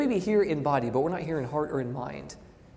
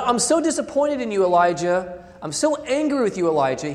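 higher, louder, scolding Elijah; angry reproach; 3 semitones higher, 8 dB louder than usual. Acted. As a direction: accuse him loudly, like a disappointed God. God voiced as angry accuser.

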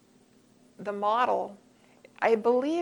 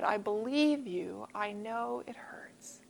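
slow, soft, fading to a whisper; tender comfort; 9 dB quieter, slower than usual. Retold or imitated. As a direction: hush it gently to a hurting child. Mother comforting hurt daughter.

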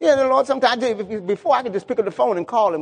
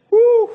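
a high, loud laughing woo after the sermon peak; excited whoop; 8 dB louder than usual. Real, not acted. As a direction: let out a joyful woo with a laugh.

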